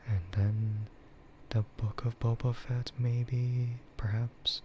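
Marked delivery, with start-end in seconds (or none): none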